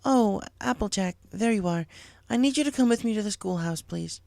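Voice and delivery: a teacher needing help; lightly voiced teacher Cheerilee. Cheerilee asking Applejack to come to school, lightly voiced.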